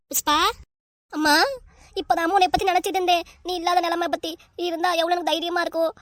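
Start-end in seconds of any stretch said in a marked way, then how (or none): 2.0-5.9 acted weeping plaint; tearful. Daughter crying to the mother she missed, tearful. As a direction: pour it out through tears. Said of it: "என்ன புஷ்பா, இப்ப அழுவுற?".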